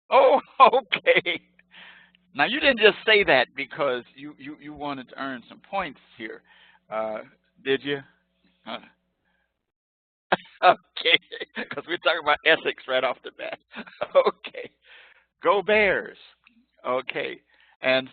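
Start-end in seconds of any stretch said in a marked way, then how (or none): 0.1-1.4 laughing. Real, not acted. Student joked back. 15.4-16.2 cheer. Real, not acted. Cheering his team.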